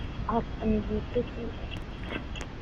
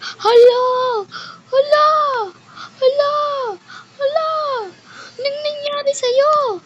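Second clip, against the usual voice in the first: very high, loud panicked cries; alarmed cry; 8 dB louder than usual. Acted. Kelsey finding John run over, crying out in shock. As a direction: shriek it in horror, almost crying. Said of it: "sabi niya".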